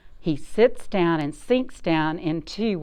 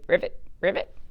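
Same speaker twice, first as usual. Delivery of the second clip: put-on playful ribbit for the kids; croaky frog call; frog croak; faster than usual. Shown as a demonstration. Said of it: "What's a frog say?".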